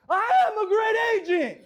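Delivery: high, loud, laughing yell; laughing mock yell of the agents; mock shouted affirmation. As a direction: belt it out like the hallway should. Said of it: "I don't walk down the hall hearing".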